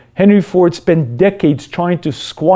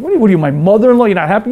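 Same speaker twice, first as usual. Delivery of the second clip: fast, higher, scoffing comeback; mocking retort; 4 semitones higher, much faster than usual. The selfish man scoffs back at his conscience, mocking. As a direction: brush it off with a sneer.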